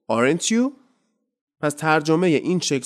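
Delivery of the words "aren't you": Aren't you? rising tag, emphatic; emphatic tag question; rhetorical tag. Shown as a demonstration. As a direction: ask Aren't you already knowing. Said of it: "rhetorical question".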